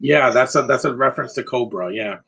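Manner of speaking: ordinary talk, nothing marked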